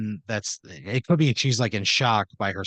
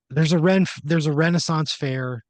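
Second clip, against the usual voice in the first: louder, higher, stumbling into the words; urgent explanation; 6 semitones higher, 5 dB louder than usual. Acted. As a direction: blurt it out as a worked-up character. Shaken brother explains urgently why lost.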